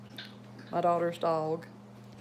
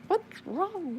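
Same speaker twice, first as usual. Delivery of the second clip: startled; 12 semitones higher than usual. Retold or imitated. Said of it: "you're like".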